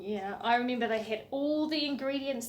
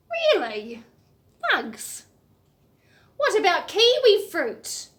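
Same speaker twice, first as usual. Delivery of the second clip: mock surprise; 7 semitones higher, 10 dB louder, slower than usual. Voicing the host character replying.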